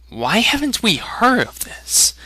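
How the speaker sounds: put-on high, eager outcry of a young lad; eager cry. A narrator's reading. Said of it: "cried Aragon".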